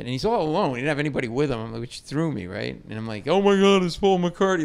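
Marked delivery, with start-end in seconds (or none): 3.3-4.6 louder, higher starstruck gasp of recognition; excited fan reaction. Retold or imitated. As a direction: blurt it out, amazed at a celebrity. Starstruck reaction on spotting the star.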